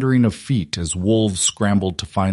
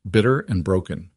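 ordinary delivery

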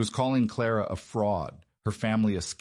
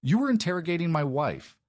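sharp, higher, louder rebuke; stern correction; 9 semitones higher, 3 dB louder than usual. A narrator's reading. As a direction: cut her off with a correction. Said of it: "Sebastian corrected".

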